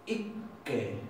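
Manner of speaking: ikke softly with a long double k; quiet repeat of ikke, holding the kk; Norwegian ikke. Shown as a demonstration. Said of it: "esto se pronuncia ikke".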